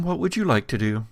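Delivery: ordinary talk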